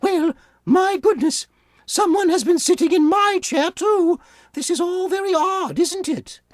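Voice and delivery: high motherly bear, gently puzzled; Mama Bear answering Papa Bear about the soup. Acted.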